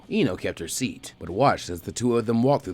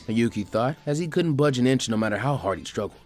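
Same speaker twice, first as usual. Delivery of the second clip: usual speaking voice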